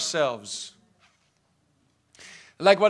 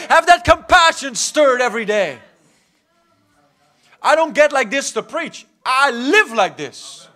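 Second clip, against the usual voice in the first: loud, driving exhortation building in repeated punches; fired-up preaching; 2 semitones higher, 8 dB louder than usual. Real, not acted. Preacher urging the church to live stirred up, fervent and loud. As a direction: stir them up with forceful, rising insistence.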